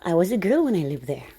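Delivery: usual speaking voice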